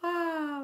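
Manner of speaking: high, loud "ah"; loud high exclamation. Real, not acted. Scanning a flood of viewer comments, loud high exclamation. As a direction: let out a high, drawn-out ah.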